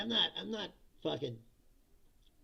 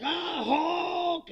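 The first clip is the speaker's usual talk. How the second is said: much higher, louder mock cry of Hulk; hammed-up cry; 10 semitones higher, 5 dB louder than usual. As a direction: cry it out like a cartoon hero in shock. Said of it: "He's like".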